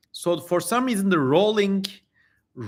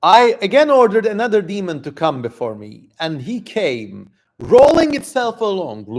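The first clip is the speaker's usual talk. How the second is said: raised, louder storytelling voice; dramatic story reading; 3 semitones higher, 3 dB louder than usual. Acted. Streamer reading the demon tale to chat, dramatic narration. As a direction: read it like a grand old tale. Streamer reads ancient text aloud.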